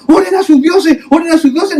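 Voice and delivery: high-pitched urgent plea; acted frantic call to the crew. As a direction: cry out like a sailor in a storm. Acts out panicked sailors calling out.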